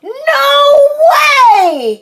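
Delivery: high, loud, stretched shout; drawn-out high yell; crying out in refusal. A narrator's reading. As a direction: refuse like a kid yelling. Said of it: "cried Froggy".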